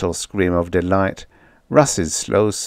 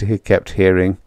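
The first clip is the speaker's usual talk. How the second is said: ordinary delivery, nothing marked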